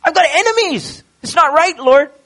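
high, pleading complaint to God, well above the normal voice; a hurt, high-pitched lament, pleading and indignant; David crying out. Retold or imitated. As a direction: wail your grievances up to the Lord like a wronged king. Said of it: "David starts off with crying out".

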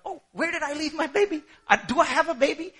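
high, fast, laughing ditzy mom voice; forgetful mother. Pretend. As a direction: play a scatterbrained mother who lost her baby.

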